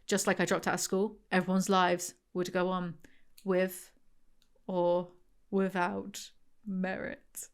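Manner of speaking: quiet, low reading of a sad first-person story; reading aloud. A narrator's reading. Reading her book excerpt to viewers, quiet and low. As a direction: read the excerpt softly and steadily.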